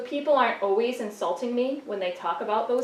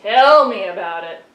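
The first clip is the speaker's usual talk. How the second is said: sarcastic retort; 10 dB louder than usual. Acted. Sheep puppet reacts sarcastically.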